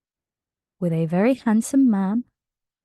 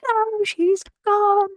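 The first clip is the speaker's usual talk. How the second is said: retold Regellis; 11 semitones higher, slower than usual. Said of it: "Regellis is like".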